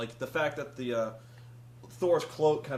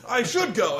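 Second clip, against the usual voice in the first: loud, raised hammed-up declaration; mock resolve; 7 semitones higher, 10 dB louder than usual. Gullible Thor deciding to go, mock resolve. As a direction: burst out in mock-heroic resolve.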